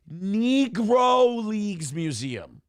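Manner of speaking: "Negro" with a long drawn-out E, slow and deliberate; lingering on the E. Shown as a demonstration. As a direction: drag out the E in "Negro" slowly. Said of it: "he lingered on the E in Negro".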